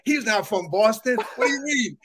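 mock indignant. Veteran buddy objecting to talk of Boston, mock mad. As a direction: imitate a buddy getting comically mad. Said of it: "he gets mad".

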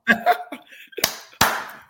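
high airy laugh; breathy laughter. Real, not acted. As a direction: keep laughing helplessly. Host keeps laughing.

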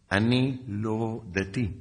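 very slow, drawn-out retort; measured, stretched delivery; slow, deliberate. Retold or imitated. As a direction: spell it out word by word, unruffled. Calm answer to a shouting sergeant.

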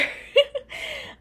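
high breathy giggle. Real, not acted. Giggling while telling date story.

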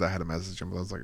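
usual speaking voice

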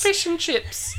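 high, silly British voice; fake British accent. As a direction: chirp it in mock British. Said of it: "wish we had a British accent".

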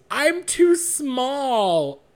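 high, drawn-out loud wail; hammed-up tearful confession, stretched out slowly; mock crying. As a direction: imitate a drunk guy bawling. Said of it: "starts crying".

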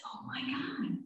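hushed, breathed disbelief; shocked whisper. Retold or imitated. Patient learning the daughters know, shocked whisper. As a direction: barely whisper it in astonishment. Shocked at the secret being known.

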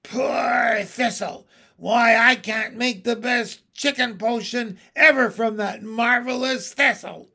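high, loud witch voice; theatrical crone wail; lamenting hag. A narrator's reading. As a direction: mourn your precious plant as a crone. Hag laments trampled thistle.

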